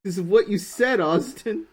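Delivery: loud, high teasing accusation; mock outrage. Co-streamer teasing Austin about the canceled game, mock outrage. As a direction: pile on the blame, hamming it up.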